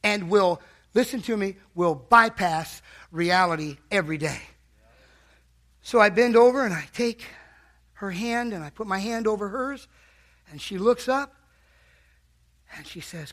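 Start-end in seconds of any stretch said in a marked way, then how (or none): none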